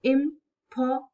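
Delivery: "impossible" pulled apart into four syllables. Shown as a demonstration. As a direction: pause after each syllable of "impossible". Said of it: "But on a deeper level, when we say".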